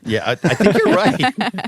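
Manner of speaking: laughing through the words; real giggle while agreeing. Real, not acted. Laughs while agreeing about rum.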